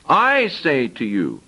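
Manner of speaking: slow, "I" stressed, falling; emphasized I. Shown as a demonstration. Preacher repeating Jesus' phrase, slow stress on I. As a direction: spell out each word with weight on "I". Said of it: "now I want to emphasize".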